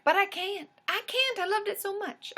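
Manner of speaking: high, gushing whine of a fan; high pleading fangirl gush; gushing protest. Retold or imitated. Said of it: "I was like".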